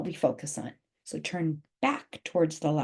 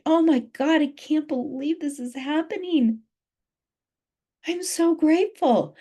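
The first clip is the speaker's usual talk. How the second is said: overjoyed; 8 semitones higher than usual. Pretend. Coach acting out the feeling of gratitude, high and thrilled. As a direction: squeal with disbelief at your luck. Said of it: "the feeling it".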